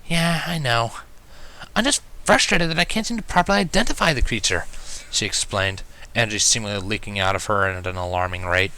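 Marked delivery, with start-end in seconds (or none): 0.1-4.7 weary Twilight. A narrator's reading. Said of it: "sighed wearily".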